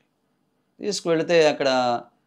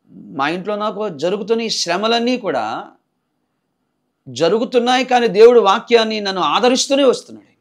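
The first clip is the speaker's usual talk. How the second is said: forceful preaching; 8 semitones higher, 8 dB louder than usual. Acted. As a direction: preach it out to the congregation. Testimony preached at full force.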